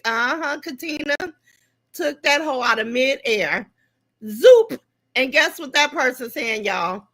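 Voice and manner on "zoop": zoop sound effect. Pretend. Mimicking a quick takedown.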